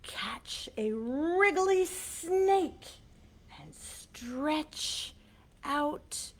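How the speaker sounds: whisper. Acted. Whispered action for children.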